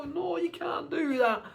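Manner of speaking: high, drawn-out mock protest; pleading parent. Parents stopping their buzzing kid, high stretched-out plea. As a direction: wail it like an exasperated parent.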